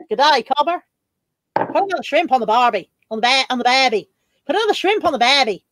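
put-on shaky-footed go at an Australian accent; attempted Australian accent. Streamer having a go after co-host, amateur Australian accent. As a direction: have a try at Australian, correcting the vowels. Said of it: "I don't think I can do it now".

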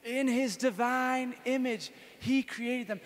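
leading recitation. Shown as a demonstration. Leading the group to repeat the verse, slow and loud. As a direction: say it with the crowd, slowly.

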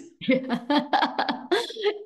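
high bright burst of laughter; real happy laugh; delighted laugh. Real, not acted. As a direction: laugh out with joy. Laughing along with guest.

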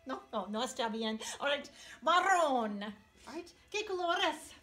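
ordinary talk, nothing marked

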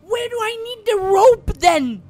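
high, loud frantic cry; frantic yelling. Real, not acted. Gamer yelling in panic at the rope puzzle.

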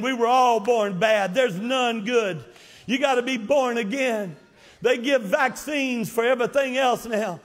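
ordinary talk

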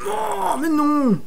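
very high whining protest; dismayed whine. Real, not acted. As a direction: whine in disbelief at the game. Masque de collision raté. (gémissement aigu et incrédule)

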